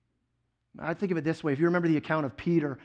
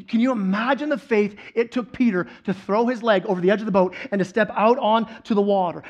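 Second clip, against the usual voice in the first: normal talking voice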